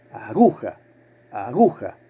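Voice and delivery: "Aguja" con la sílaba GU marcada, a-GU-ja; acento en GU. Shown as a demonstration. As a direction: remarca GU al decir "Aguja". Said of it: "¿Cuál suena más fuerte de las tres?". (aguja con la sílaba del medio fuerte)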